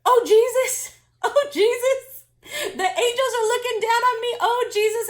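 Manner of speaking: high, loud cries of delight; overjoyed exclamation. Retold or imitated. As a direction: imitate her rapturous outburst of thanks. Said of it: "And she said".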